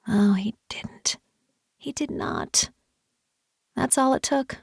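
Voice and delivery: slow, breathless disbelief; soft whisper. A narrator's reading. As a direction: whisper it, undone. Narrator overwhelmed inside.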